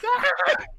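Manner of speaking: very high, loud yelp; mock scream. Customer dragged out the back door, high mock scream. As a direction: yelp like someone being hauled off. Thrown out, retold.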